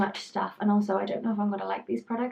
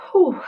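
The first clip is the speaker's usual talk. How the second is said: breathless whew; 9 semitones higher, 12 dB louder than usual. Retold or imitated. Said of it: "Sometimes I just".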